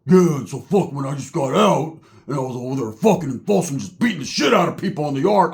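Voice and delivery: lightly voiced gruff convict bragging; a hint of a gruff biker boasting; gruff ex-con voice. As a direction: brag like an old prison yard vet. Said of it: "I hear a gruff voice".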